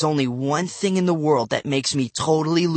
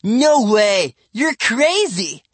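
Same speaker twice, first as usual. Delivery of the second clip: high, emphatic protest; raised, incredulous kid voice; indignant denial; 8 semitones higher, 5 dB louder, much slower than usual. A narrator's reading. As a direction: scoff at him, drawn out. Boy denies it in quarrel.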